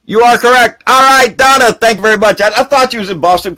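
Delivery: high, loud hearty thanks; cheerful thanks. Real, not acted. Thanking Donna for correcting him, a loud cheerful thanks. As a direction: beam out the thank-you.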